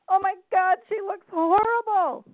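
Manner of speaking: high, loud shocked outburst; old woman yelling across a restaurant; shrill mock yell. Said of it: "who yelled out in the middle of a restaurant".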